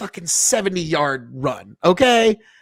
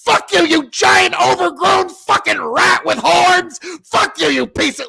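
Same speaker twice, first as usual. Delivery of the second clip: high, furious shout of curses; cursing at the deer; 12 semitones higher, 4 dB louder than usual. Retold or imitated. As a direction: scream abuse at the deer lying on the road. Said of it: "start fucking cursing at that fucking deer".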